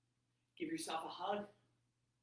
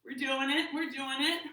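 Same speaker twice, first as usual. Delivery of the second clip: excited; 7 semitones higher, 6 dB louder than usual. Real, not acted. Cheering on yoga class.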